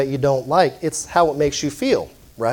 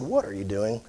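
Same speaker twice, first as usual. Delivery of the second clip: low, soft self-scolding mutter; muttering to yourself; 5 semitones lower, 11 dB quieter than usual. Retold or imitated. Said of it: "spoke out loud to yourself and said".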